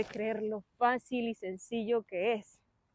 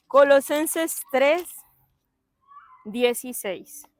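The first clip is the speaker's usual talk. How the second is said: Colosenses tres dieciséis slowed right down, loud on tres; slow announcement; 2 semitones higher, 7 dB louder, slower than usual. Real, not acted. Preacher announces the verse slowly to the congregation. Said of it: "estoy nerviosa".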